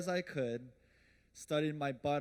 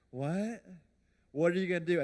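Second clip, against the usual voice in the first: loud, higher panicked mother; alarmed mom; 4 semitones higher, 7 dB louder than usual. Retold or imitated. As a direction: demand it as a shocked mom.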